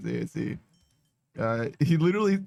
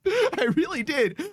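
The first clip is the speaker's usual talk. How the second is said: laughing; 12 semitones higher than usual. Real, not acted. Laughs at own mistake.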